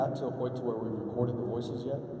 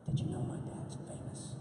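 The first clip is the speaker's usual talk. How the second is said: put-on secretive boyish whisper; 5 dB quieter than usual. Son confiding in his best friend over the iPad, whispering. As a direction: whisper it like the world's greatest secret. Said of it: "a whispering conversation like this".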